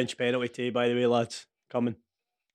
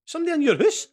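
alarmed disbelief; 12 semitones higher, faster than usual. Real, not acted. Alarmed at a ghost.

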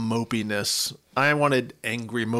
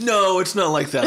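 laughing; 5 semitones higher, 4 dB louder than usual. Real, not acted. Laughing at the candle joke.

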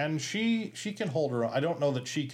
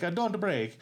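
a lightly higher character voice; Farley's character voice; 9 semitones higher, slower than usual. Retold or imitated. Telling how Farley warned Sandler in the character's voice, lightly voiced. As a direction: whisper it in character to a costar. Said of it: "in the character's voice".

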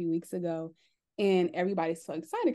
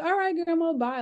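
cheerful goodbye; 10 semitones higher, 5 dB louder than usual. Retold or imitated. Saying bye to grandma after feeding.

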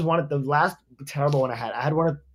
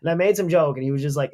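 ordinary talk, nothing marked

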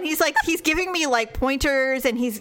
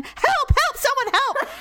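frantic call for help; 13 semitones higher, slower than usual. Retold or imitated. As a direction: yell for help as if stranded. Calling nurse for help.

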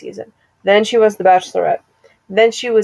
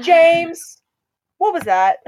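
Wendy Williams; 7 semitones higher, slower than usual. Retold or imitated. Re-enacting a classic clip, imitated startled call. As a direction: lightly mimic a startled talk show host. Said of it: "That's a classic Wendy Williams clip".

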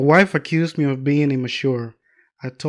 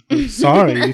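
laughing; 5 semitones higher, 5 dB louder, slower than usual. Real, not acted. Laughing mid-sentence on podcast.

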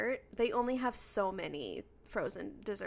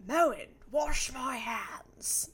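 slow, shouted, theatrical command; booming slow command; 2 semitones higher, much slower than usual. Retold or imitated. A viewer mocking the sink ad with a booming slow command. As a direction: bellow it like a grand old orator. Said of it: "I interpreted it as".

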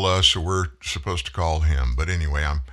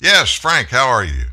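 loud, raised voice to the caller; shouting into a phone; 5 semitones higher, 7 dB louder than usual. Real, not acted. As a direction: project over a bad phone line.

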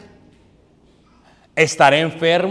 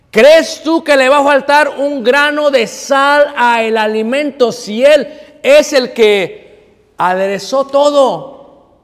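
slow, higher and rising, hammered rhetorical questions; full-force preaching; 6 semitones higher, 4 dB louder, slower than usual. Acted. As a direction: drive it home to the congregation, slowly. Preaching climax.

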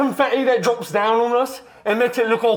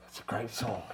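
whispered aside; 13 semitones lower, 17 dB quieter than usual. Acted. Aside after song title.

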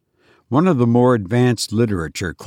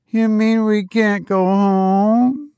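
put-on higher, slow, dismayed child; a small child realising she can't leave; little girl asking the robot, higher and slow; 10 semitones higher, slower than usual. A narrator's reading.

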